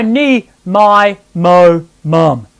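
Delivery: singing exercise on nasal syllables. Shown as a demonstration. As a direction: lean into each N onset. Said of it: "I want you to go".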